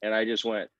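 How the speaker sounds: ordinary talk, nothing marked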